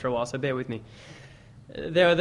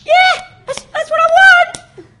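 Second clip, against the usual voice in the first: squeaky voice; 7 dB louder than usual. Acted. Third guy in squeaky voice.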